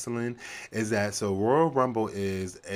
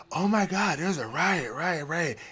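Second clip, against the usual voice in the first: high, shrill mock panic; mock panicked onlooker, very high; 9 semitones higher than usual.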